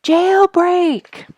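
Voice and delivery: high, loud cry of alarm; cried out. A narrator's reading. Duke crying out from the squirrel cage, loud alarm. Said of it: "he cried".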